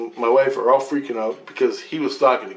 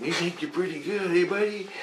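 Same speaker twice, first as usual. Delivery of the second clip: higher, softer needling voice; mocking taunt; 7 semitones higher, 7 dB quieter than usual. Man rubbing the teller's shoulder, mocking taunt. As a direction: mimic someone patronizing a buddy.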